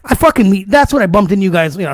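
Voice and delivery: ordinary talk, nothing marked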